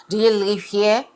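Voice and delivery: lightly voiced nosy Karen; mock the nosy question.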